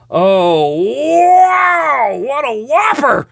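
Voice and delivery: huge drawn-out high wow, shouted; real whoop over a big card pull; thrilled amazement. Real, not acted. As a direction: shout it out in high-pitched awe.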